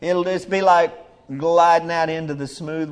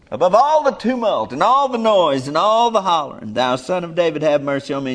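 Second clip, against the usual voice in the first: high and loud pleading call; crying out; 5 semitones higher, 7 dB louder than usual. Acted. The beggar crying to Jesus above the crowd's hollering. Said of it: "Blind Bartimaeus cried out the more".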